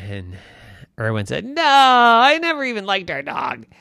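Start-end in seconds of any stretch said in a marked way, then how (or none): 1.4-3.7 put-on high child voice refusing; sulky boy. Irwin refusing the dog, high sulky boy voice. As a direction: refuse with a pout.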